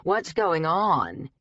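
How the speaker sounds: slow, sassy drag queen drawl. Acted. Magic mirror greeting its owner in a drag queen drawl. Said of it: "in its drag queen drawl".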